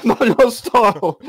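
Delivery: high, slow amused echo; mock indignation. Game master mocking the player's refusal, amused echo. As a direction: parrot his words back in mock disbelief.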